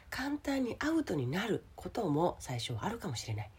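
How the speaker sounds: usual speaking voice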